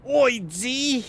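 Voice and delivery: put-on high, loud, aggressive British accent shouted; aggressively British Reska. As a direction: bark it out in an over-the-top British accent. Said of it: "gonna make it more aggressively British".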